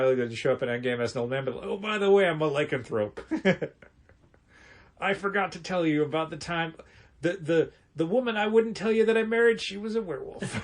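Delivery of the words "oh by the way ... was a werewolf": put-on old-timey Cap voice. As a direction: play an old gold-panner. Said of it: "I like your old-timey Cap voice".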